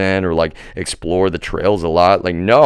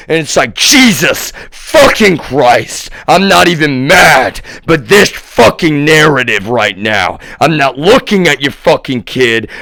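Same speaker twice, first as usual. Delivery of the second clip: loud, higher, slow heated rant; angry outburst; 6 semitones higher, 7 dB louder than usual. Real, not acted. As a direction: let the anger spill over. Furious rant about being accused.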